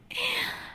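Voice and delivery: put-on high, girlish sinister giggle; villainous titter; creepy giggle. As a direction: giggle with cruel delight. Villain toying with prey.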